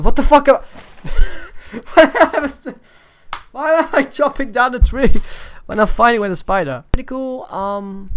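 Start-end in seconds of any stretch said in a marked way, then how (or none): 0.0-6.8 baffled shout. Real, not acted. Confused mid-fight.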